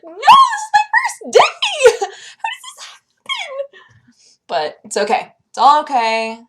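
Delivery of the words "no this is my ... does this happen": very high squealing cry of dismay, laughing through it; hammed-up despair on her first day; mock wail. Vlogger replaying her first-day panic in a squeal. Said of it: "It's like".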